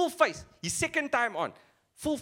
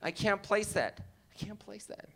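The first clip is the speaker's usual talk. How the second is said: put-on puzzled American accent; mimicked American accent; 3 dB quieter than usual. American puzzling over his accent, mock American accent. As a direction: put on an American trying to place it. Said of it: "They're always like".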